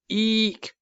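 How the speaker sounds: high shrill little owl screech; tiny owl's squeaky screech; owlet screech. A narrator's reading. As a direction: screech like a baby owl trying to sound grown-up. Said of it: "It sounded like the noise a cat makes".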